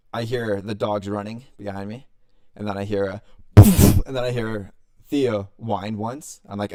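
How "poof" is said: loud, breath-blasted "poof"; explosive sound-effect poof; imitated poof. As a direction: burst the word out like a sudden thump. Imitates the noise he heard.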